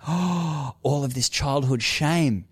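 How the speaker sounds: put-on lightly high voice airing old shame. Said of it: "I'm like".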